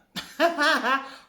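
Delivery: loud, high burst of laughter; laughing. Real, not acted. Teacher amused by a student's silly question, laughing out loud. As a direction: crack up in a quick high cackle.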